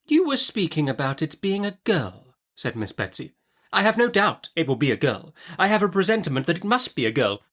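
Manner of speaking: high, brisk stern aunt; Miss Betsy. Acted. Aunt Betsy interrogating the young mother, brisk and high. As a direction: snap the question like a stern old aunt.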